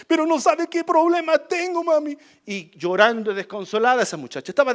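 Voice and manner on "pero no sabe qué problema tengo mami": distraught daughter. Retold or imitated. Crying daughter runs to mother.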